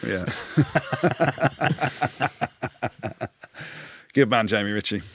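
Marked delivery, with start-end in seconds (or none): 0.3-3.3 long run of chuckles; real, sustained laughter, raised in pitch. Real, not acted. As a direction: laugh on and on, amused. Amused by co-host's confession.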